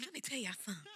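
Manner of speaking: teasing soft impression of a friend; imitating soothing voice. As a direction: softly mimic her catchphrase through a laugh. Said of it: "you have a very soothing voice".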